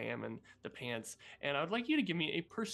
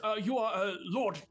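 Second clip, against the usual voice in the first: higher, louder badger announcing; 6 semitones higher, 4 dB louder than usual. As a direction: proudly announce the lord's title. Badger NPC names squirrel.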